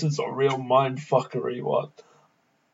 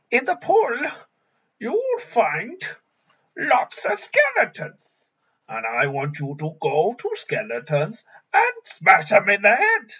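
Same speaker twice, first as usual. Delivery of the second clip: high, squeaky Goblin King voice; 6 semitones higher than usual. Acted. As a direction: screech orders like a goblin ruler. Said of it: "I'm gonna need a little Goblin King".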